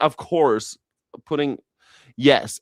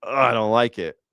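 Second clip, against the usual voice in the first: ordinary talk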